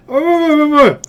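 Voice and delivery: high, repeated "oh" cries; high-pitched acted outcry. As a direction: cry out in a rapid string of ohs. GM voices what is heard.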